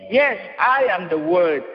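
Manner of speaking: ordinary talk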